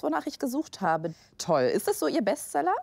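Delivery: ordinary delivery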